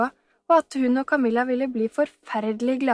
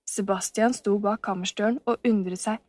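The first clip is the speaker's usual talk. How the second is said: ordinary delivery, nothing marked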